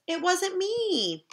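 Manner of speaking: slow, high, whiny protest; innocent denial. Acted. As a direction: plead innocence like a kid. Child denies blame.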